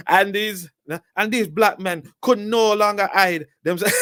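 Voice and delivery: exaggerated high-pitched Jamaican accent for the quote; over-the-top Jamaican accent. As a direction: ham up a Jamaican accent, far too much. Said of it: "let's just do a Jamaican accent".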